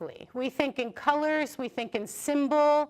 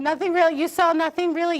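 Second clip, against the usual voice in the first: louder, higher, playfully incredulous; surprised echo; 4 semitones higher, 7 dB louder, faster than usual. Real, not acted. Teacher echoing a student's odd answer to the class, amused disbelief.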